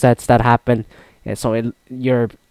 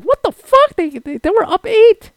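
much higher, incredulous squeak; stunned disbelief; 14 semitones higher than usual. Retold or imitated. Fan learning his team came back to win, in shocked disbelief. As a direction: act out the shock at the comeback win. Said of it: "I was like".

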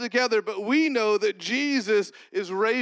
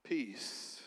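murmured low and very soft; whispered thanks to Jesus; hushed prayer; 12 semitones lower, 22 dB quieter than usual. Real, not acted. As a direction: breathe the prayer almost under the breath. Hushed prayer between points.

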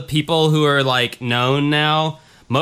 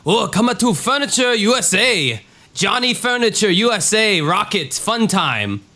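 cheesy ad announcer; 7 semitones higher than usual. Pretend. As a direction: sell it like a cheap TV ad. Mock furniture store ad voice.

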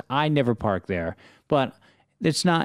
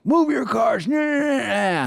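high-pitched mocking yell trailing into nah-nah noises; 13 semitones higher, slower than usual. Neighbor storming into the backyard, mock yelling. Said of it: "she yells at him".

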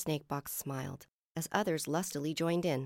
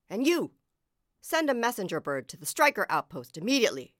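loud, raised command; barked order; 5 semitones higher, 6 dB louder than usual. A narrator's reading. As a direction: bark orders at a subordinate. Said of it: "he barked".

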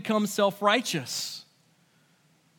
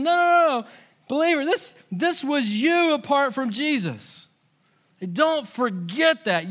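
emphatic preaching; 7 semitones higher, 5 dB louder than usual. Pretend. Pastor voicing Paul correcting the believers, loud and insistent. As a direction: voice pushed up high and loud, insisting. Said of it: "And Paul's saying".

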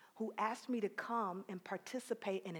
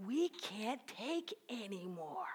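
fearful thought; 5 dB quieter, slower than usual. Retold or imitated. Retold fearful inner thought.

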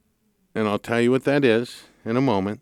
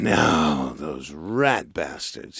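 lower, softer and slow, a grumbled mock curse; mock disgust; 7 semitones lower, 5 dB quieter, much slower than usual. Host retelling his slow, low, soft grumble at the lender. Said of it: "and I'm going".

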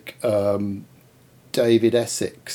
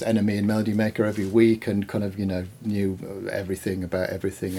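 ordinary delivery

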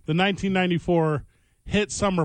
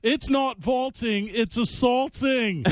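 slow commentator pun; 5 semitones higher, slower than usual. Pretend.

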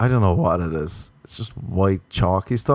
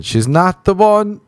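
brain screaming; 9 semitones higher, 3 dB louder, slower than usual. Pretend. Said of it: "my brain doesn't start screaming".

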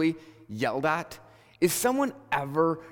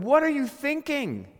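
much higher, exasperated acted cry; red-faced outburst; 12 semitones higher, 4 dB louder than usual. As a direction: act out losing patience with foolish people. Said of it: "red in the face going".

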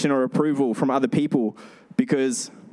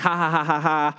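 laughing along. Retold or imitated. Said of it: "I'm laughing".

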